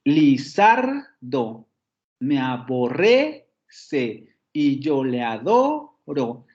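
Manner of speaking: "Lizardo me aborrece y yo le adoro" slow, rising on ZAR, RRE, DO; drawn-out "li-ZAR-do, a-bo-RRE-ce, a-DO-ro"; accent pattern marked. Shown as a demonstration. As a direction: scan syllable by syllable, lifting ZAR, RRE and DO. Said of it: "el patrón de acentos que aparece en el primero".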